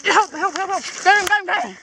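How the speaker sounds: high, loud, urgent repeated calls; real frantic plea to a mate; urgent cry for help. Real, not acted. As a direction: call for help mid-struggle. Struggling to hold the wombat, calls help.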